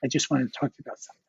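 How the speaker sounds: soft, quick, polite lead-in; gently retold polite request, hushed. Colleague phoning an artist about his work, politely and softly. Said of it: "very politely was".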